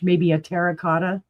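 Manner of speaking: normal talking voice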